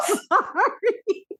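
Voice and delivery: high squealing laugh, then slow apology; laughing through pain. Real, not acted. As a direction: laugh till it hurts. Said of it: "It hurts to laugh".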